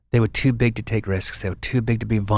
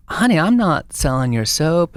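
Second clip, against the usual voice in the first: put-on higher-pitched, drawn-out funny Texas accent; 7 semitones higher, 4 dB louder than usual. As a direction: imitate a Texan woman in a comic accent. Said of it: "in her funny Texas accent".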